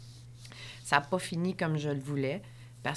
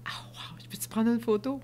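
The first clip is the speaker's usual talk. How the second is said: starstruck whisper; 4 semitones higher than usual. Retold or imitated. Starstruck asking for photo.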